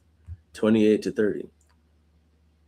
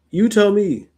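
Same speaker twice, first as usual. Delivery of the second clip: high, loud, pleading challenge; 9 semitones higher, 5 dB louder than usual. Retold or imitated. Job pleading with Eliphaz, high and loud. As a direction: beg and dare him at once, up high. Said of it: "He's pleading".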